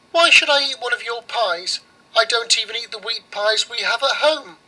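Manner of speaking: put-on high, proud little girl; girl's voice. Girl scorns the oven's rye pies, high and haughty. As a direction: turn the pie down with a toss of the head.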